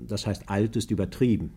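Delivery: normal talking voice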